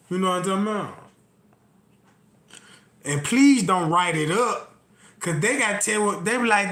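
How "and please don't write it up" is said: ordinary delivery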